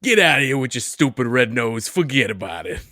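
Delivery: bully Rudolph in a hammed-up New York accent; Brooklyn accent. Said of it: "keep those accents up".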